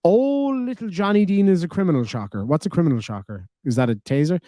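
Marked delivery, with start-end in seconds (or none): 0.0-2.4 sneering mock newsreader voice, high on the oh; mocking read. Shown as a demonstration. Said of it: "let me try this again".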